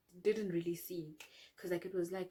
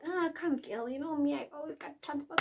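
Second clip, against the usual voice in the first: higher, breezy mock-Angela promising a ride; imitating Angela; 7 semitones higher, 3 dB louder than usual. Angela assuring her friend she has transport, lightly imitated. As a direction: toss it off like a friend who's got it covered. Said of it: "Angela was like".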